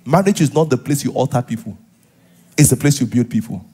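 usual speaking voice

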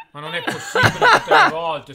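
father laughing despite himself at son's prank; exasperated laugh. Real, not acted. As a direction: laugh it out, fed up with the joke.

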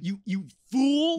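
high, loud theatrical scold; mock villain.

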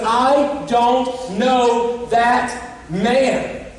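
slow denial. Acted. Peter denying Jesus a second time.

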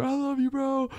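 high, squeaky farewell to his little brother; a doomed passenger's last words, hammed up; mock tearful goodbye. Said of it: "my little brother".

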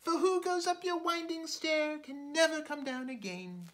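high, light little fly; a small wary insect turning him down. Acted. Fly refusing the spider's invitation, high small voice.

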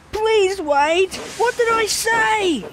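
a digger pleading with Thomas to stop; calling after him. Acted. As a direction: call out anxiously to a friend leaving. Scoop calls after departing Thomas.

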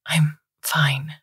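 whispered, on the edge of tears; teary whisper. Acted. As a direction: choke it out in a wet whisper. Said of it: "It came out sounding wet".

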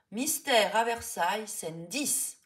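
a loud stentor voice calling the scene; stentorian shout. A narrator's reading. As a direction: bellow it across the set like a director. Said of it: "crie une voix de Stentor".

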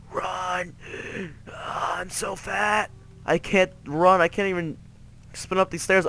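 high, gasping complaint while fleeing; winded whining. Pretend. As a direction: gasp and whine about having to run. Struggles to run up stairs.

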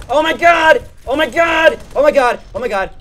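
high, loud overwhelmed yelling; frantic shout. Real, not acted. Gamer swarmed by enemies, frantic shouting. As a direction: yell in a frenzy.

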